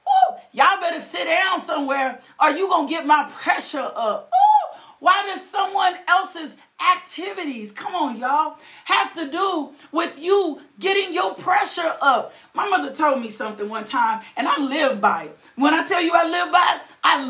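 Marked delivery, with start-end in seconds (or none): none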